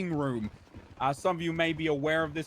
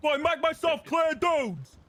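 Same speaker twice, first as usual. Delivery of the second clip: loud gung-ho jock; 7 semitones higher, 3 dB louder than usual. Acted. Jock cop yelling at recruits.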